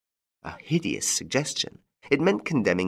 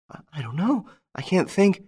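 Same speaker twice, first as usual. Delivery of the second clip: frightened murmur; 11 semitones higher than usual. Acted.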